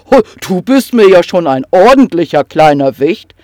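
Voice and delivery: loud, grumbling troll voice. Acted. Troll grumbles at the fussy little kobold, loudly. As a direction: rumble it out good-naturedly. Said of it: "brummte der Troll".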